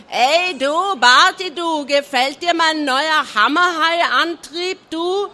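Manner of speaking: loud, high mocking bellow across; taunting yell. A narrator's reading. Hammerhead bellowing taunts over to Barty. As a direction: taunt the rival across the water. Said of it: "brüllte rüber".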